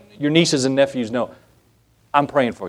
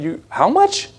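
high, incredulous mock outcry; shocked at the price; 9 semitones higher, slower than usual. Customer to theme park cashier over corn dog, shocked. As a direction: balk in comic disbelief, pitch shooting up.

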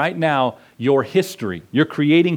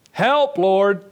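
higher, drawn-out retold plea; imitating a sinking man's plea; mock alarmed cry; 4 semitones higher than usual. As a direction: act out calling to be rescued. Peter sinking, calls again.

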